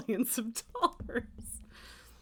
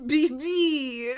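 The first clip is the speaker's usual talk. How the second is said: high, loud cooing to a cat; cutesy pet call; 4 semitones higher, 7 dB louder than usual. Real, not acted. Calling the cat baby, playfully.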